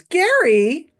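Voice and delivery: high, loud rising question; mock surprise. Magician acting surprised at the brother's name, high and loud. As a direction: feign shock at the name. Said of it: "And he goes".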